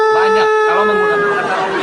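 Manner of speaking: high, loud, fast shout. Real, not acted. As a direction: yell over him to be heard. Heated debate, shouting over opponent.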